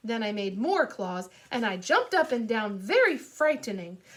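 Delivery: ordinary delivery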